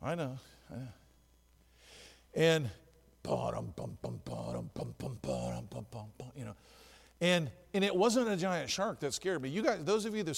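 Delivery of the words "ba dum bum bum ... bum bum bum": deep menacing ba-dum bum hum; low Jaws theme hum. Shown as a demonstration. Preacher hums the Jaws theme to the congregation, low and ominous. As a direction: hum the shark music low and soft. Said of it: "watching the movie Jaws".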